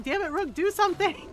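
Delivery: mock exasperated demand. Poor Lucanus urging Rook to act, mock exasperated demand.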